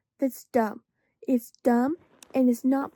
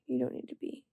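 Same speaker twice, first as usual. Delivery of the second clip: much softer, tender to the pet; soft reassurance; 9 dB quieter than usual. Real, not acted. Reassuring the pet who came to cuddle, softly. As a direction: soothe the animal gently.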